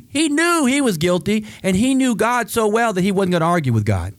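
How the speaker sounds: high, ringing preacher's call; fired-up sermon peak; preaching at full force. Acted. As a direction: lift it up and drive it home to the church.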